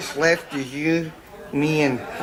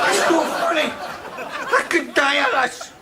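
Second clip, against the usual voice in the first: laughing protest; 10 semitones higher than usual. Acted. Protests while laughing at pink wine.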